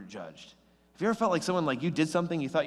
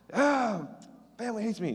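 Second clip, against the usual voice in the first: mock whine; 9 semitones higher than usual. Mock self-pity of rejected teen.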